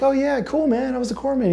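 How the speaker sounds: high, soft casual reply; friendly chit-chat voice. Retold or imitated. Self voiced high, softer.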